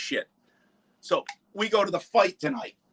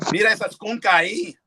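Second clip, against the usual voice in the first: imitating Puerto Rican buddies; 5 semitones higher, 3 dB louder, faster than usual. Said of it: "They're like".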